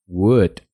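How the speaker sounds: wood drawn out slowly with a short u; English pronunciation. Shown as a demonstration. Teacher models the word for learners, slowly. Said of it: "se pronuncia".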